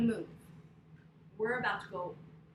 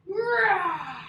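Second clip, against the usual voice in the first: big loud bear roar, higher; 6 semitones higher, 11 dB louder than usual. Shown as a demonstration. Yoga teacher to kids in bear pose, loud roar. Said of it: "let's roar for the bear".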